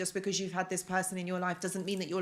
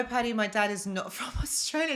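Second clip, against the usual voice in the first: ordinary talk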